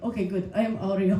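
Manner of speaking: low, softly satisfied deadpan; understated mimicked introduction; imitating fellow comedian. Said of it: "he was like".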